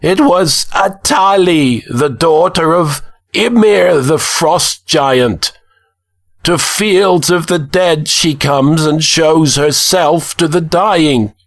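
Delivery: put-on high, fervent old man's voice; wild older man. A narrator's reading. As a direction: proclaim it slowly, high and wild. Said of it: "cried an older man whose eyes were wild and weird".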